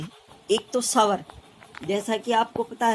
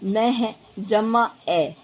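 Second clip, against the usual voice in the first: slow, deliberate spelling of a syllable; slower than usual. Shown as a demonstration. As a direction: build the syllable slowly for students. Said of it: "यहाँ मैंने जैसे मैं लिया है". (धीरे-धीरे अक्षर जोड़कर बताना)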